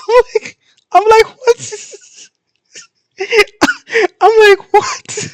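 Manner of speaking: very high, squeaky words lost in laughter; helpless incredulous laughter. Real, not acted. Reader reliving the shocking scene, helpless incredulous laughter. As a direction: laugh so hard the words barely get out.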